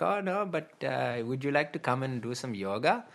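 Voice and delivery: high, lightly voiced Indian yoga man; eager yoga man. Retelling the train stranger's invitation, high mock voice. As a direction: mimic the pushy stranger's sing-song invite.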